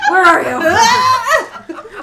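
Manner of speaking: high, loud, dragged-out unhinged cry; Nicolas Cage impression. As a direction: stretch it out like a manic horror villain. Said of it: "Nicolas Cage".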